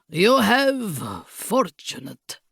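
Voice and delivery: put-on slow villain's Bragislanian accent; thick Bragislanian accent. A narrator's reading. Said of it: "in his thick Bragislanian accent".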